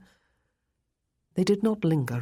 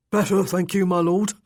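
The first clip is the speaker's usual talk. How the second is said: put-on loud, higher-pitched old servant voice; an old retainer answering his lord; put-on voice of an elderly servant, raised and loud; elderly manservant; 3 semitones higher, 6 dB louder than usual. A narrator's reading.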